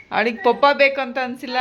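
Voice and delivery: ordinary talk, nothing marked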